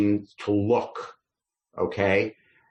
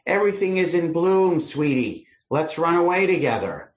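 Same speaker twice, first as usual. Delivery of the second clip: higher-pitched, hammed-up sweetheart's plea; wooing lover; 7 semitones higher than usual. Man to his sweetie through the window, playfully voiced wooing lover. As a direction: coax a sweetheart in a raised voice. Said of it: "So the man is saying".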